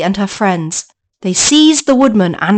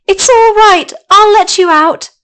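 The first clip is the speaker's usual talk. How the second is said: high, loud reassurance; Dorothy calling to the caged lion, high and loud; eager girl; 9 semitones higher, 6 dB louder than usual. Acted. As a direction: call it out eagerly.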